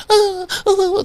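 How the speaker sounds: high, quiet mock whimpering; imitating a rattled girl babbling to herself; tweaking out. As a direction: whimper in little high bursts. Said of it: "talking to herself".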